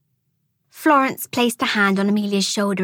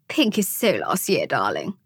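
casual put-down, dropping very low on "darling"; 6 semitones lower than usual. A narrator's reading. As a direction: toss it off coolly, sinking at the end. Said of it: "said Tangine casually".

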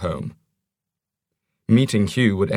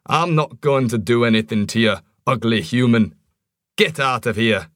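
gruff dwarf; 2 semitones higher than usual. Acted.

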